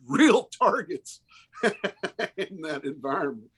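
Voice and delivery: laughs through the words, slowed; a rueful laugh while talking. Real, not acted. Laughs about being targets.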